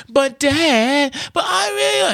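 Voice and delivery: put-on high, whiny kid's pout; pouting child. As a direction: whine like a sulking child. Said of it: "I start pouting".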